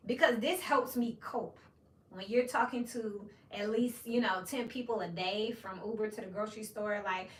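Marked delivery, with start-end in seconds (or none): none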